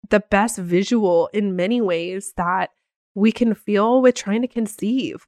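ordinary talk

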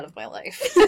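laughing through the words. Real, not acted. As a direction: crack up mid-line.

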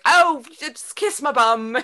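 high, loud, drawn-out mock scoff; slow, exaggerated imitation of a dismissive child; imitated scoffing. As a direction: scoff like a fed-up little British girl. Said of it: "just scoffing at me".

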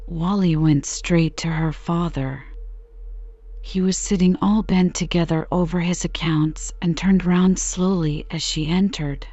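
ordinary delivery

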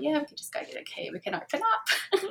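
high, breezy mock naive voice; giggly, high and fast naive thought; naive past self. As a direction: play your silly carefree younger self. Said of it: "silly little me thought".

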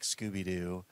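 normal talking voice

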